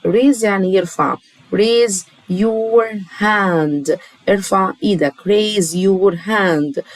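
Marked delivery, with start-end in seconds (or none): 1.6-4.0 patient classroom drill pace; slow model phrase. Shown as a demonstration. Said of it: "اتكرر ورايا". 5.1-6.8 patient classroom drill pace; slow model phrase. Shown as a demonstration. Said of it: "اتكرر ورايا".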